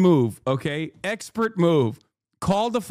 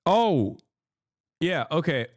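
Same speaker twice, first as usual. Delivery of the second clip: ordinary delivery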